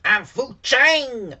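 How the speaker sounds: slow high loud shout; character shout. Retold or imitated. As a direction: belt out the name slowly. Impersonates character name shout.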